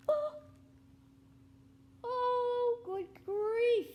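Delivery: high gasp then loud good grief; shocked at what Arthur has become. Real, not acted. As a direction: gasp and exclaim.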